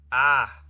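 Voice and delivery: short, loud cry of frustration; sudden yell. Real, not acted. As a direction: burst out with a yelp. Sudden cry at confusing game screen.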